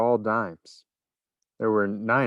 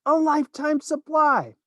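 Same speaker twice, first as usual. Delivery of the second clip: high envious voice; 4 dB louder than usual. A narrator's reading. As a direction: grumble with envy. Envious boy continues.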